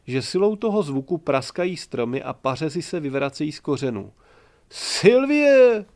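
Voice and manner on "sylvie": very high, loud joyful shout; happy bellow. A narrator's reading. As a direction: roar her name across the woods. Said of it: "volal šťastný Jan Čmelda". (radostné hlasité zvolání)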